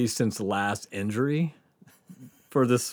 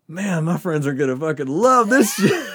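laughing thought; 6 semitones higher than usual. Retold or imitated. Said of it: "I'm laughing 'cause I'm like".

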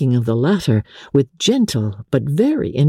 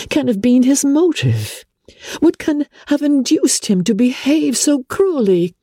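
put-on higher young woman's voice, shocked and indignant; Elizabeth, indignant; 12 semitones higher than usual. A narrator's reading. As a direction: rise up in disbelief at the injustice. Elizabeth questioning.

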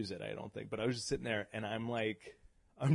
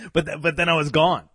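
dramatic exit; 6 semitones higher, 11 dB louder than usual. Retold or imitated.

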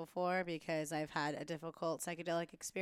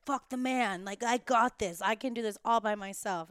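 loud, raised, cocky self-quote; defiant bravado; 5 semitones higher, 10 dB louder than usual. Retold or imitated. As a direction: act out a headstrong rebel going it alone. Mimicking own defiant past self.

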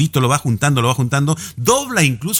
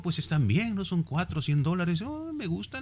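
soft, much quieter musing voice; much softer, pondering the chairs; quiet quoted buyer; 3 semitones higher, 12 dB quieter than usual. Retold or imitated. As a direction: murmur the buyer's thoughts to yourself. Quoting buyer softly.

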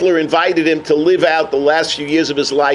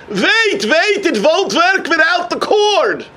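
calling out; 9 semitones higher, slower than usual. Acted. Wheelchair man calls after thief.

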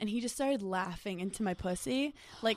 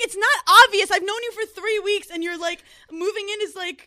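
high, loud, frustrated plea; exasperated protest; 10 semitones higher, 10 dB louder than usual. Retold or imitated. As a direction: protest in disbelief. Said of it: "And I'm like".

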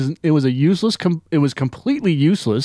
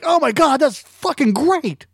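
much higher gushing fan, slowing on the end; mock excited fan; 11 semitones higher, slower than usual. Podcaster mocking a fan meeting Aquaman, much higher gush. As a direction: squeal it like a starstruck fan. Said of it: "And Jeff's like".

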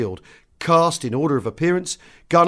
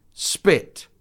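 ordinary delivery, nothing marked

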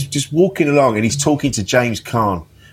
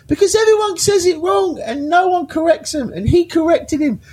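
much higher, shouted in delight; excited outburst. Retold or imitated. Explaining the joke to puzzled friends, high excited shout. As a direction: cry it out as if nobody else gets it.

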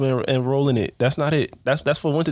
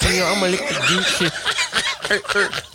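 mock big-talking girl; 5 semitones higher, slower than usual. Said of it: "a lot of girls be big talking".

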